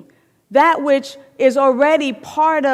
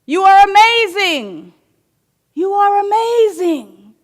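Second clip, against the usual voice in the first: loud high joyful shout; parent shouts praise; 8 semitones higher, 6 dB louder than usual. Acted. Parent shouting praise to the mountain, loud and high. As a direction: call it out proudly. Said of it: "says ... yells back the mountain".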